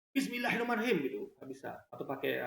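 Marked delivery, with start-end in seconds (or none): 0.1-1.0 Bismillah in a high, quick Batak accent. Shown as a demonstration. Host shows followers Bismillah in Batak dialect, high Batak accent. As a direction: recite it briskly, pitched up, with a Batak accent. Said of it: "bahasa Batak".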